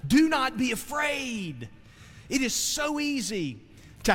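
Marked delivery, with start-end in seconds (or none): 0.1-1.7 higher, slower, each word pressed; emphatic command. Acted. Preacher giving the Lord's words to his flock, emphatic. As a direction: plant every word firmly. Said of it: "here's what he's telling us".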